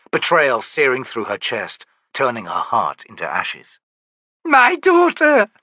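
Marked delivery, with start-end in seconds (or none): 4.4-5.5 high, loud, slow wail; terrified mother crying for her daughter; anguished cry. A narrator's reading. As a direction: cry the words out, drawn long. Myby in anguished terror.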